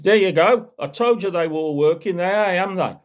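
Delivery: high, loud taunting copper; mock triumphant constable. Constable gloating to Jeremy after smashing his lights, high and loud.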